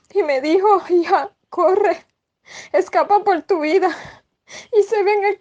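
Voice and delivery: high, tearful voice breaking through the words; crying. Real, not acted. Woman recounting her dream to the church, weeping high and broken. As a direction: speak through real tears, voice cracking high. Weeps retelling a dream's verdict.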